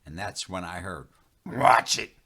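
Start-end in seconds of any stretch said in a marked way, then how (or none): 1.4-2.2 sharp, much louder warning; warning shout. Acted. Running Bear stopping the narrator's step with a loud warning. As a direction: bark out a sudden stop.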